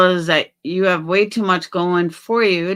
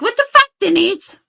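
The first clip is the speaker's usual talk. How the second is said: much higher, louder mock outrage; high, shocked fake-fan yelp; imagined hater; 14 semitones higher, 5 dB louder than usual. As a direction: squeal it like an outraged stranger.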